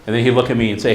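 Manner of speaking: ordinary talk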